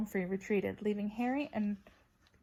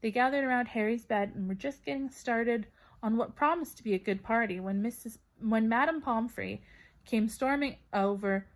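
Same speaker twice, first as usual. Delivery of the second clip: plain reading voice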